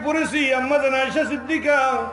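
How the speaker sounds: high, loud melodic proclamation; impassioned, high-pitched sermon chant; full-force preaching. Real, not acted. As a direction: ring it out to a big congregation.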